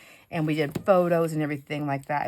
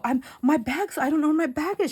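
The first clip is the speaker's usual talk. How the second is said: flustered panic; 10 semitones higher than usual. Retold or imitated. Panicked self-quote at airport.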